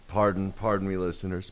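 ordinary talk, nothing marked

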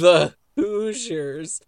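very slow, stretched-out name; drawn out. Acted. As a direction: drag out each syllable of the name. Mock echo of wrong name.

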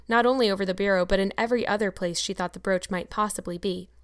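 plain reading voice

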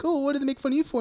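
high, soft mock-concerned voice; Pennywise. Host retells the clown coaxing the girl, mock concern. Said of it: "And he's like".

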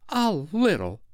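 high, offended echo; haughty. A narrator's reading. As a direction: repeat it with hauteur. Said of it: "she replied with hauteur".

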